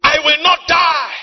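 loud, high cry to God; impassioned declaration. Retold or imitated. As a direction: declare it forcefully in prayer. Pleading with God, declaring life.

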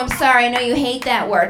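usual speaking voice

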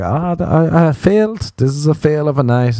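high-pitched exasperated lament; exasperated outburst. Retold or imitated. Comedian recalls giving up on the night, high exasperated outburst. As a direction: groan about it, pitched right up. Acting out his frustration at failing.

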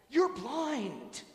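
loud, high astonished outburst; blurted out. Retold or imitated. Young girl to blind priest after sudden astonishment, blurted out. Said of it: "she blurted out".

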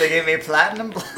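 teasing words through laughter; laughing mockery. Real, not acted. Teasing friend about her wig, laughing. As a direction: laugh while parroting her.

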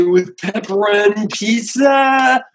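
slow goofy party-dude voice; goofy turtle voice. Retold or imitated. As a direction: drawl it like a fun turtle. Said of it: "I didn't get to say".

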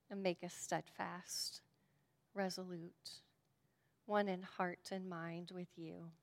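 hushed pastoral prayer. Acted. Leads a closing prayer.

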